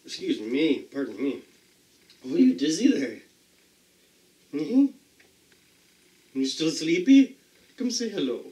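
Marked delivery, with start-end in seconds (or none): 2.2-8.4 high sing-song pet voice; dog talk. Real, not acted. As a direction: coo at the sleepy dog.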